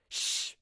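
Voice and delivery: sharp hiss to silence him; hissed shush. Acted. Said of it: "she hissed".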